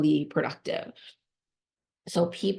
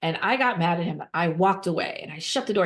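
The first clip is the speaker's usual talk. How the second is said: ordinary delivery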